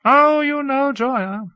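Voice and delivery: high, slow, airy dismissal; light. A narrator's reading. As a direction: brush it off lightly. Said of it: "Hawke said lightly".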